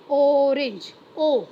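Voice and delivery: ऑरेंज with a loud, long opening "o", then ओ alone; opening vowel stressed. Shown as a demonstration. Teacher showing orange's spoken first sound, opening vowel stressed. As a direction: punch the "o" that starts ऑरेंज and repeat ओ. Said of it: "बोलते समय speaking के time पे क्या आ रहा है".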